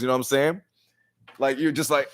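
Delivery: usual speaking voice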